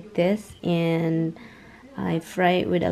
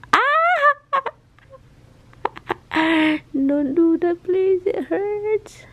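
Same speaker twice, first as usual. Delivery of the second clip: high yelp, then pleading; real pained squeal; pained cry; 11 semitones higher, 4 dB louder, much slower than usual. Real, not acted. Pet bites owner.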